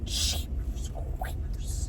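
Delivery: slow breathy whisper. Real, not acted. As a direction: whisper it slowly in shock. Aside at the price.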